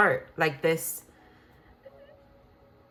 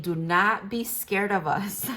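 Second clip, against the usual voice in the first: ordinary talk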